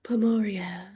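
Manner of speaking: breathy product-name whisper; shampoo ad whisper. Shown as a demonstration. That sounds kinda like a shampoo.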